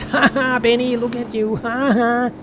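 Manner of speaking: high mocking laugh, taunting; jeering taunt. Rival mocking Benny with a high taunting laugh. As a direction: sneer and laugh at him. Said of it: "then says".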